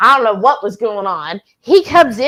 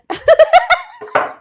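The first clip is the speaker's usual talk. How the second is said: a loud, high burst of laughter; high squealing laugh; 6 dB louder than usual. Real, not acted. Laughing hard at her husband's paddle comment, a high squeal. Big laugh after scolding.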